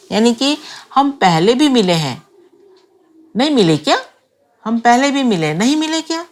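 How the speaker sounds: "नहीं मिले क्या" pitched high and lifting at the end; unsure rising question. Shown as a demonstration. Speaker unsure, asking for confirmation, rising tone on "नहीं मिले क्या". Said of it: "I am not sure".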